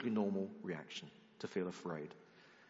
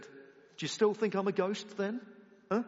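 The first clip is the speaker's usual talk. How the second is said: mock taunting; 9 semitones higher, 4 dB louder than usual. Jesus mocking Peter, imagined.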